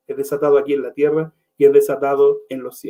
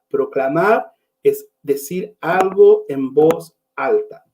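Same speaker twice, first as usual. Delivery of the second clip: slow, word by word, spelling it out; slow emphatic repeat; slower than usual. Shown as a demonstration. Preacher repeats his definition slowly for the congregation. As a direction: let each word land for the congregation.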